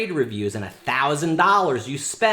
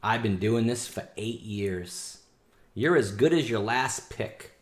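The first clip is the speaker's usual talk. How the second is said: ordinary delivery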